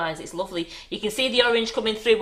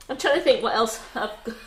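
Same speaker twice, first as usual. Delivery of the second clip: ordinary talk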